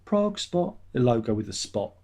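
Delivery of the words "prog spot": high sung-out jingle tag. Pretend. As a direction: announce it like a radio jingle. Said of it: "imagine it's got a jingle".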